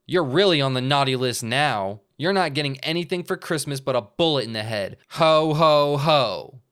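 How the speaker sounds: menacing Santa. Acted. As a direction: gloat with a dark ho ho. Bug Santa threatening.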